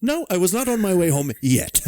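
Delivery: put-on high, huffy old codger; indignant old man. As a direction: correct them in old man voice.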